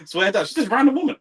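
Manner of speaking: a mocking, disbelieving exclamation; mock outraged disbelief. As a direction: react like you can't believe it. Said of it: "I was like".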